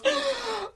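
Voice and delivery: high sharp gasp of shock; startled intake of breath. Retold or imitated. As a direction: gasp as if seeing the wreck. Father sees crashed car.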